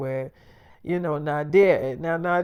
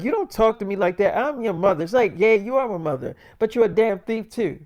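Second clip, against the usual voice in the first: raised, defiant talking back; a daughter snapping back at her thieving mother; angry retort; 5 semitones higher, 5 dB louder than usual. Retold or imitated. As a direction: push back loud and hard at her own mother. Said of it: "she like".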